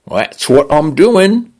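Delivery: the home AI speaks in a breezy Cockney lilt; Cockney male voice. A narrator's reading. Smart home greets its owner in chirpy Cockney. Condo voice replies.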